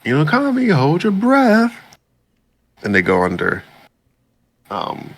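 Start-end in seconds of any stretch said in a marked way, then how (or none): 0.0-1.7 character voice. Retold or imitated. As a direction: stretch out the urgent order in a squeak. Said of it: "He's like".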